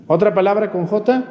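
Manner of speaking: ordinary talk, nothing marked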